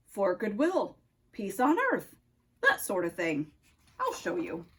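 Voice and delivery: put-on low-pitched Russian-accented nutcracker; deep Russian accent, a sentry's challenge. Nutcracker questions Oscar on the tree, low Russian accent. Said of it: "spoke in a Russian accent".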